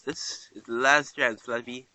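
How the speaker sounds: ordinary talk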